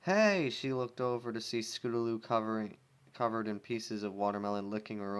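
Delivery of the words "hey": angry call. Acted. Rainbow yelling at Scootaloo.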